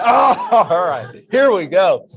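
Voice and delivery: loud, high and laughing, ready to go; fired-up excitement. Retold or imitated. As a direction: burst out like a kid getting the ball. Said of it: "I was so excited".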